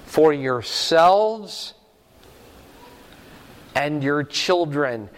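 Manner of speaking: yourselves drawn out slow; stressed slowly. Shown as a demonstration. Preacher stressing the same term to the class, slow. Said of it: "He uses the exact same term".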